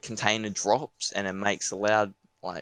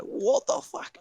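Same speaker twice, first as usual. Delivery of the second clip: shocked; 12 semitones higher, slower than usual. Retold or imitated. Shocked reaction to threat.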